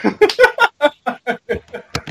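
a burst of high giggling; high squeaky laugh. Real, not acted. Laughs at the snoring joke.

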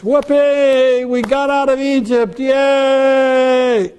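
joyful whooping shout at the feast; jubilant cheer. Retold or imitated. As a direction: cheer like a freed crowd shouting for joy. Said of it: "the mood of Passover is".

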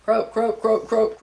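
toad croak. Shown as a demonstration. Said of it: "What do toads do?".

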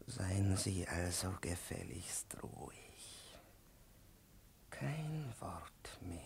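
slow, unvoiced, soft command; menacing whisper. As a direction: hiss the order quietly. Professor hushes the pupil.

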